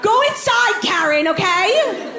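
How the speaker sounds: high, exasperated call out; exasperated yell. Acted. Telling pot-bangers to go inside, high exasperated call. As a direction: call out at the balconies.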